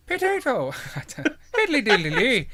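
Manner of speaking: high-pitched, hammed-up mock Irish; bad Irish accent, breaking into laughter. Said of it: "You do Irish accent".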